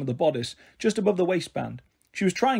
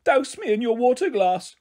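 panicked plea; 8 semitones higher than usual. A narrator's reading. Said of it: "she cried".

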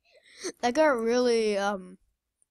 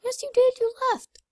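pitched way up, insisting; high accusing; 13 semitones higher, 5 dB louder than usual. Real, not acted. Accusing friend of leaving game.

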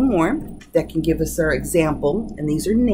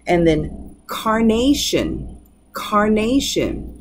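carnation said slowly with the stress on NA; slow stress demo; 2 semitones higher, slower than usual. Shown as a demonstration. Teacher slowly modelling carnation with the stress on NA. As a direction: draw out carnation, leaning on NA. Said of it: "You can hear it in the penultimate syllable".

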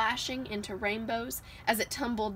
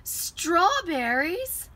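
long, slow, high cry of strawberries; excited shout; 9 semitones higher, 6 dB louder, much slower than usual. Acted. Cub cries his favorite dessert, a slow excited shout. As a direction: stretch it out with delight, high and loud. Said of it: "Little Bear shouted".

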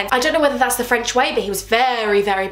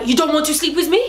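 higher mock French accent, incredulous question; bad French accent; 5 semitones higher than usual. Rejected boyfriend questions her, bad French accent. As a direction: imitate an affronted Frenchman's accent. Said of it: "doing a really bad French accent".

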